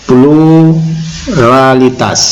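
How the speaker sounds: PLURALITAS very slow, split after a long first syllable; drawn-out PLURALITAS. Shown as a demonstration. Preacher teaching listeners a term, PLURALITAS slowly drawn out. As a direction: stretch out "pluralitas" part by part.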